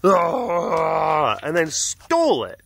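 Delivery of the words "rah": mock big-cat growl; seriously mean snarl. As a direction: roar like an angry cat. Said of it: "like, seriously mean".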